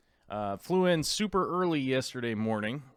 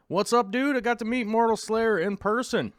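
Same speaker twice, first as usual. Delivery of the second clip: much higher and louder excited shout-out; excited greeting; 11 semitones higher, 6 dB louder than usual. Real, not acted. Host greeting a fan he met in person, excited. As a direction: gush over meeting a fan.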